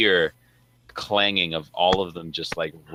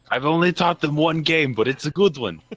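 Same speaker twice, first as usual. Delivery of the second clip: high, loud gleeful shout; loud proud yell to the crew; shouted boast; 10 semitones higher, 5 dB louder than usual. Acted. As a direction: proudly bellow it over the ruckus. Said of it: "I just shout out".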